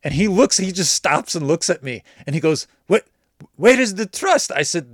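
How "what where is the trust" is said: louder, higher, outraged at being doubted; indignant disbelief. Retold or imitated. Buyer protesting to seller who wants payment first, loud indignant disbelief. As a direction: act hurt that he's not trusted. Pedro indignant at Zelle demand.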